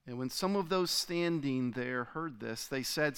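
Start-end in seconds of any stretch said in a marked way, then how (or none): none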